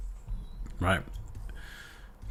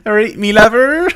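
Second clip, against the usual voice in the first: high, loud broad West Country greeting; a jolly Somerset yokel; hammed-up West Country farmer talk; Wurzel West Country voice; 11 semitones higher, 11 dB louder than usual. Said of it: "Wurzel does illustration".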